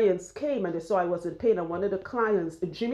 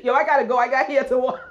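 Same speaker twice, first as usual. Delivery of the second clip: loud, higher, laughing phone sign-off; mock holler into the phone, amused; imitating Jimmy; 6 semitones higher, 7 dB louder, faster than usual. Partner cutting short a call with his boys, loud laughing imitation. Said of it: "Jimmy is like".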